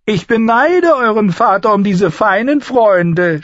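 high, loud, scoffing dwarf; mocking. A narrator's reading. Dwarf scoffs at the lady's fine friends, high and mocking. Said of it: "spöttelte Lannister".

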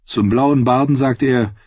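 usual speaking voice

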